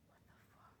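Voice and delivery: breathy unvoiced what the fuck; shocked whisper. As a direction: whisper it in disbelief.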